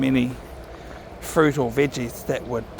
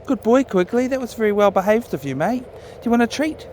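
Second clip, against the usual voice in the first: dog praise voice; 7 semitones higher than usual. Real, not acted. Owner praises his dog Quigley for behaving, higher pet-talk.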